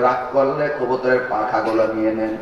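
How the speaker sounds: low, cheeky nephew; nephew replying. Retold or imitated. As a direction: slip the retort in low. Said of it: "কয়".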